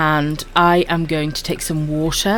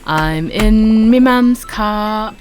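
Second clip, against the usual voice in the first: slow, higher meme-quote voice; 5 semitones higher, much slower than usual. Retold or imitated. To chat, slowly quoting the viral meme voice. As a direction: drag out the viral line.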